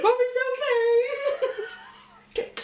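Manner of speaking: high, lightly hammed-up puppet mum. Voicing a dead mother as a puppet, high mock mum voice.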